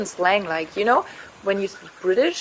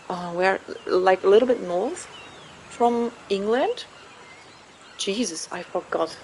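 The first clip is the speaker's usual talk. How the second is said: ordinary talk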